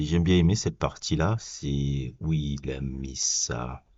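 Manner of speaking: usual speaking voice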